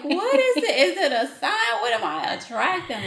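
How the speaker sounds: hammed-up puzzled asking the universe; mock bewildered. Charlotte mocking her own puzzlement at sad strangers, high and loud. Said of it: "I'd be like".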